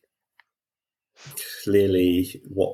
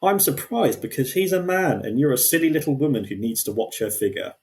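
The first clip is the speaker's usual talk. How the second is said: mock condescending; 6 semitones higher than usual. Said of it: "what I wrote in response".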